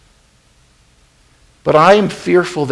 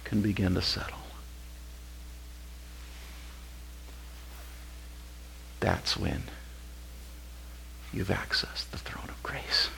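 breathy hushed whisper; 7 semitones lower, 19 dB quieter than usual. Real, not acted. Preacher hushing the congregation at the throne of grace, whispered. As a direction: whisper it as the dust settles. Quiet moment before God.

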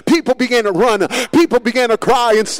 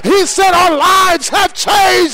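preaching climax; 6 semitones higher than usual. Acted. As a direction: build and build until the church is on its feet. Sermon climax, testimony shouted.

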